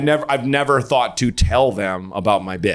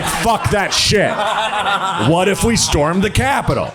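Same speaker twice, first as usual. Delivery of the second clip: mock shout; 6 semitones higher than usual. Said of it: "I was like".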